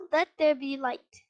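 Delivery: ordinary delivery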